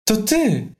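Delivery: high, startled "to ty"; mild shock. A narrator's reading. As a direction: caught off guard, a touch of shock. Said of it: "w lekkim szoku". (lekko zszokowane, wysokie to ty)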